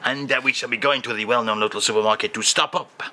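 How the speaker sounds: usual speaking voice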